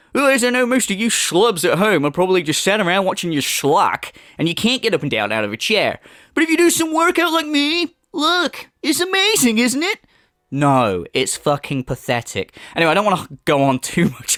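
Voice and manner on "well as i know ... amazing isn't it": high, condescending show-off voice; mocking smug lecturer. Mocking the streamer preaching to viewers. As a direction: talk down to the viewers, pleased with yourself. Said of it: "But wow".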